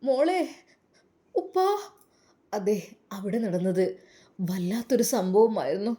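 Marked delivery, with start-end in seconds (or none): 0.0-1.7 higher, startled cry of recognition; shocked recognition. Acted. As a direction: gasp out the name in shock. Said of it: "അവർ ഞെട്ടിപ്പോയി". (ഞെട്ടലോടെ തിരിച്ചറിയൽ)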